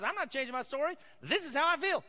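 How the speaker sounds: high, loud, insistent complaint; stubborn protest. Acted. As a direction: dig in and cry it out in defiance. Acting out stubborn Elijah defying God.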